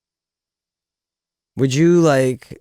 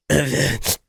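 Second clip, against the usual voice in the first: struggling with shirt; 3 semitones higher, 3 dB louder, much slower than usual. Acted. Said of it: "struggle would be like".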